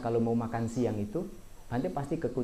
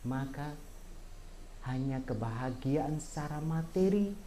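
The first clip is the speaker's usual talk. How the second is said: dhamma preaching; 5 semitones higher than usual. Acted. Monk teaching lay followers about change, earnest preaching. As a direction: lay the teaching on the audience, measured and firm.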